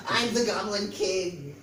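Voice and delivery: slow, lightly voiced proud goblin; a hint of pompous little king; slow mock-regal goblin; proud goblin boast. A narrator's reading. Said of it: "said Goblin".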